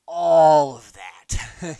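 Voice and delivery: ALL stretched long and loud; exaggerated emphasis. Real, not acted. Host mocks the overdone act, drawn-out loud "all". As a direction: lean hard and slowly on "all".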